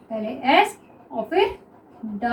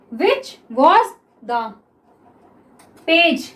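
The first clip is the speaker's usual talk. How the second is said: each word drawn out slowly; slow dictation; much slower than usual. Shown as a demonstration. Teacher dictates the next sentence to students, very slow. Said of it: "क्या हो गया ये".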